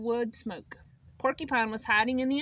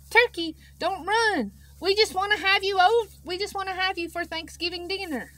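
calling after; 8 semitones higher, 5 dB louder than usual. Acted. Moose chasing turkey, calling.

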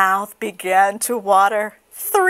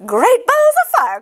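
very high shocked goose exclamation; goose crying out; 6 dB louder than usual. Acted. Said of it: "cried the goose".

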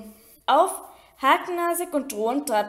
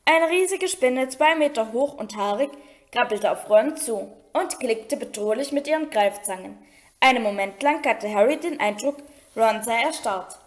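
usual speaking voice

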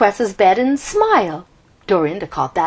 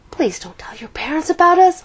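whispered pleading; begging; 9 semitones higher than usual. Acted. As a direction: beg in an urgent whisper. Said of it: "Oliver begged".

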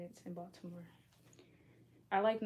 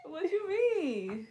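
laughing tease; 9 semitones higher, 3 dB louder, much slower than usual. Real, not acted. Teasing her friend.

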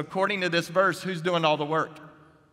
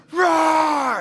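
long, loud, high lion roar; mighty roar; 12 semitones higher, 9 dB louder than usual. Acted. Lion father scaring off his son's enemy, mighty roar. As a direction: let out a huge drawn-out roar. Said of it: "there was this other roar".

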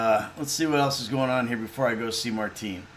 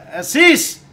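higher, louder shout of the name; calling out; 10 semitones higher, 8 dB louder than usual. Acted. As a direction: call him out sharply. Calling out to character.

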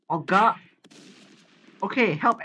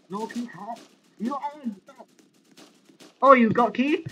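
usual speaking voice

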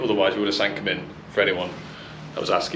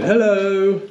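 mock hello; 11 semitones higher, 5 dB louder than usual. Mock greeting through wall.

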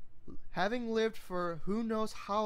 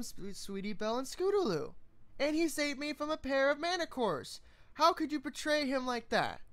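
high pleading voice, upset; high upset young mare; 6 semitones higher than usual. Acted. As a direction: defend him, close to tears. Upset filly defends him.

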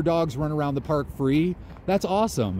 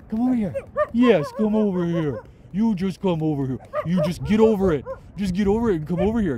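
put-on doggy baby talk; 7 semitones higher than usual. Vlogger sweet-talking a shy dog, high baby talk.

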